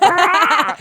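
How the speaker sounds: loud high yelp through laughter; laughing yelp. Retold or imitated. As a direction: yelp while cracking up. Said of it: "went back".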